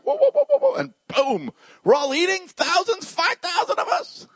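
very high, giddy astonishment; acted high-pitched marvelling; amazed disciple. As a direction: squeal the miracle in rising excitement. Voices amazed disciples at miracle.

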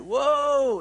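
loud, high, drawn-out call to a horse; calling whoa. Acted. Rider calling to stop a horse, loud drawn-out whoa. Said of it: "that word woe when you're riding a horse".

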